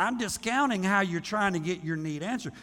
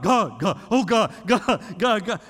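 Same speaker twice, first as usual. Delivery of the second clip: louder mock whining plea; nagging God; 5 dB louder than usual. Preacher mimics believers nagging God like a child, louder mock whine. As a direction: pester God like a needy child. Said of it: "That's what we do to God".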